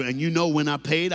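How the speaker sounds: normal talking voice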